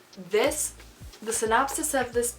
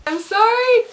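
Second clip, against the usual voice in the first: squealed apology; 14 semitones higher, 16 dB louder than usual. Real, not acted. Apologizing with a laugh.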